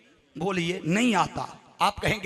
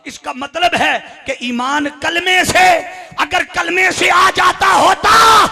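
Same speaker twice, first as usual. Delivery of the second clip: slow, loud shout climbing high; full-force preaching; 8 semitones higher, 10 dB louder, slower than usual. Acted. Preacher reasoning with the congregation, rising to a thunder. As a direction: build the argument up to a drawn-out shout.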